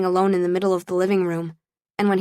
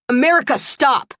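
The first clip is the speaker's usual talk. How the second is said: much higher and louder sharp command; loud yell; 6 semitones higher, 4 dB louder than usual. Acted. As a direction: shout her name to stop her. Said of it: "Shepley yelled, louder than I'd ever".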